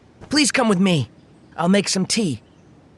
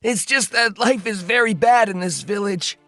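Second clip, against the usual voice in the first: understated tearful account; tearful villager. Acted. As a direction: tell it with a touch of grief, breaking down at the end. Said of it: "through his tears".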